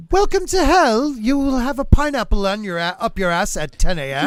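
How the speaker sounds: hammed-up British hell host; greeting the damned in hell, in a mock British accent. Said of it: "British accent definitely is hell".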